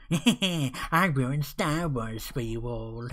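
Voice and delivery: slow, smug imitation; smug mockery. Mocking the director's smug smile, slow and smug. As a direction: drag it out like a smug director gloating.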